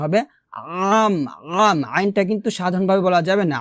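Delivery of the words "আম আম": আম squeezed from mid-throat; pressed ain. Shown as a demonstration. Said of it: "গলার মাঝখান থেকে চাপ দিয়ে".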